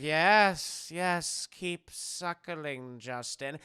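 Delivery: creepy host coaxing his captive; sinister host. Retold or imitated. Voicing the sinister host character.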